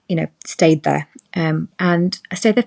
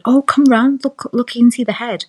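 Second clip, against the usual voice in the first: excited nurse; 6 semitones higher than usual. Retold or imitated. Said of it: "her saying to my husband".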